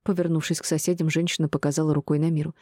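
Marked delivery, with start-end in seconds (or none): none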